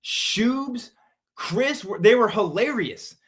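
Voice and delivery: high, loud, insistent; protesting the host's jab about season one; emphatic protest. Real, not acted. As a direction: push back fast and high. Defending himself against teasing host.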